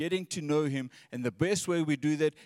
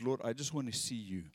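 softer and lower, each line settling down; soft longing prayer; 3 semitones lower, 3 dB quieter than usual. Acted. David speaking tenderly to the Lord, soft and low. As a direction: pray it quietly, longing to see God. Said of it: "His emphasis is".

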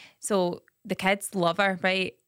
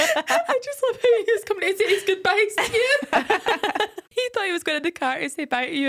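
laughing through words; 12 semitones higher, 4 dB louder than usual. Real, not acted. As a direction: talk while barely holding back tears of laughter. Laughing while retelling funny story.

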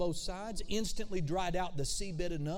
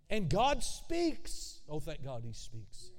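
ordinary talk, nothing marked

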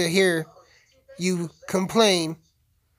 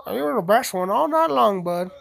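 higher put-on voice of the wife; voiced wife praising; 3 semitones higher than usual. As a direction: praise Bud in her voice. Voicing Sissy praising Bud.

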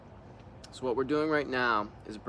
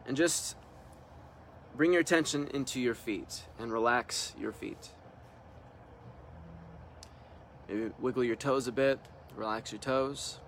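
calm meditation leader leaving room to breathe; guided meditation; 5 dB quieter than usual. Real, not acted. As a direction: guide them into relaxing, unhurried, one short instruction at a time. Leads a guided relaxation meditation.

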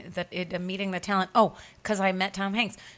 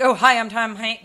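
loud, showy celebrity intro; mock grand star greeting; pompous self-introduction; 2 semitones higher, 7 dB louder than usual. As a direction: announce yourself like a big star. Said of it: "He was not like".

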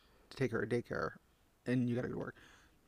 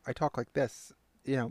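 usual speaking voice

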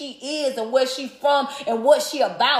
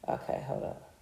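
quiet aside; 15 dB quieter than usual. Real, not acted. Drops to a whisper aside.

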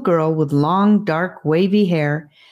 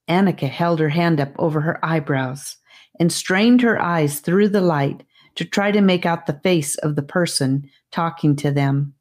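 plain reading voice

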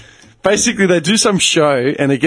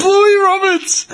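laughing; 14 semitones higher, much slower than usual. Real, not acted. Laughing, repeating the name.

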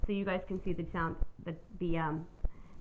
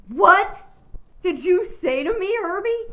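very high, loud disbelieving shout; acted-out incredulous yell; shocked outburst; 12 semitones higher, 13 dB louder than usual. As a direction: blurt it out loudly, hammed-up shock. Acted stunned reply to manager.

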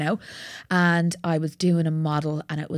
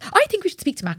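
much higher, louder mock outrage; outraged friend; 13 semitones higher, 5 dB louder than usual. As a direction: play the roommate fuming for her. Friend outraged on her behalf.

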